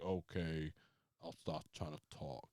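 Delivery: very low, hushed reluctant reply; Undertaker. Retold or imitated. Wrestler told to start talking, deep reluctant murmur. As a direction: sink deep and quiet, barely talking. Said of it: "And he was like".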